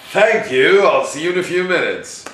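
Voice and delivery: loud, high, assured and breezy; exuding confidence. Shown as a demonstration. Actor handed a cold read, exuding confidence. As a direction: ring it out with full confidence. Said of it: "with confidence that exudes".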